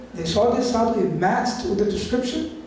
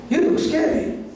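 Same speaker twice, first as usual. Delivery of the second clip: says it through a laugh, pitched higher; 8 semitones higher than usual. Acted. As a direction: tease him with a laugh. Yashoda turns away the scary sadhu.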